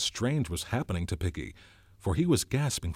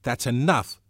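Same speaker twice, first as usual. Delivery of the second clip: sharp; 5 semitones higher, 7 dB louder than usual. A narrator's reading. Ralph silencing Piggy, sharp and louder. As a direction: cut him off, louder and firm. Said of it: "said Ralph sharply".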